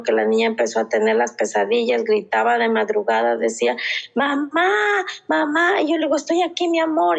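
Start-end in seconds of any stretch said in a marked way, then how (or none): 4.1-5.8 higher, pleading cry for mama; frightened child calling. Retold or imitated. Mother imitates daughter calling her from a nightmare, frightened. 6.2-7.2 higher, gentle reassurance; soothing mother. Retold or imitated. Mother retells calming her daughter at night, tender.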